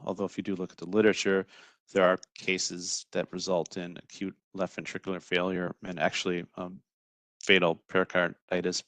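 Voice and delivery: ordinary talk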